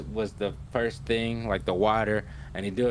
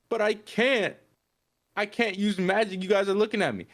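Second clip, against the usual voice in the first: high-pitched mock whine of distress; hammed-up pleading, pitched way up; imitated crying protest; 10 semitones higher than usual. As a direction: plead helplessly in a much higher voice. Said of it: "he's crying".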